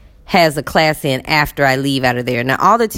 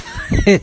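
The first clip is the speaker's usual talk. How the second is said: high-pitched imitated chuckle; mock laugh. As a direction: mimic his laugh in short bursts. Acting out his joking retreat.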